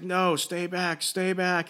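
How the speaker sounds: high, drawn-out mock warning; imitated panicky plea; mock freak-out. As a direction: act out fending someone off in alarm. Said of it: "she starts freaking out".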